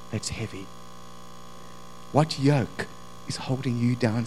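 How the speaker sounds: soft, low, tender preaching; hushed pastoral reassurance; gentle appeal. Real, not acted. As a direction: draw them in quietly, with pauses. Gentle appeal after reading Scripture.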